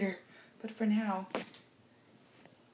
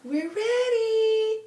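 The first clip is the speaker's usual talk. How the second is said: excited; 9 semitones higher, 4 dB louder, much slower than usual. Real, not acted. Excited start of filming.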